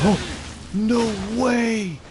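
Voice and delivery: high, loud disbelief; shocked shout. Real, not acted. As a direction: yell it in amazement. Amazed at the player.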